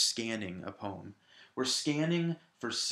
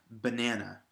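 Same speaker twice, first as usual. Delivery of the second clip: "banana" with the middle syllable stressed. Shown as a demonstration. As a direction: say "banana" the standard way, leaning on NAN. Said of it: "said exactly as I've just said it".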